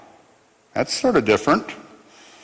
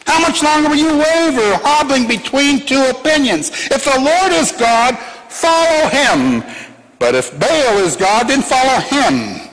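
forceful preaching; 9 semitones higher than usual. Acted. Preacher re-reads Elijah's challenge forcefully.

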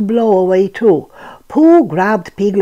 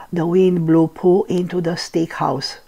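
ordinary delivery, nothing marked

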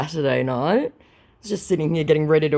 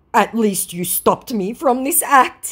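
high put-on voice; hammed-up grateful plea; mock-earnest, pitched up; imitating scammer; 8 semitones higher, 4 dB louder than usual.

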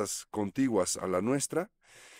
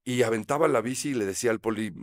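ordinary talk, nothing marked

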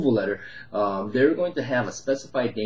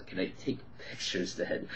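whispered, much softer timid question; meek ask; 10 dB quieter than usual. Retold or imitated. Investor asking permission after the tenant's outburst, timid whisper. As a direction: ask sheepishly, barely aloud.

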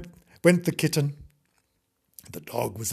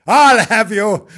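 high, loud, drawn-out threat; menacing dog; 6 semitones higher, 11 dB louder, slower than usual. Acted. Dog coming back for the kitten, menacing. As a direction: relish the threat with mock menace.

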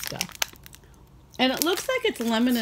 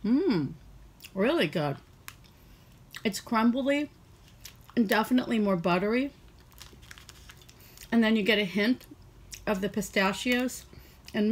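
chewing while talking; speaking between bites; mouth full of cookie; 2 semitones higher than usual. Real, not acted. Seen 0: Bit cookie at -4; lips pursed, chewing motions throughout labelled words.

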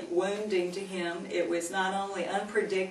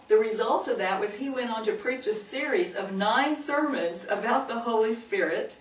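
ordinary talk

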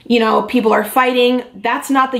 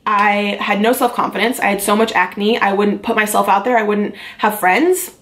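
normal talking voice

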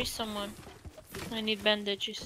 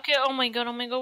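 ordinary delivery

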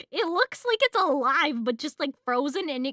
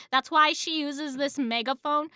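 ordinary talk

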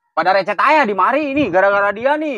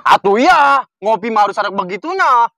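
excited insisting; 3 semitones higher, 6 dB louder than usual. Acted. Insisting on coffee ritual.

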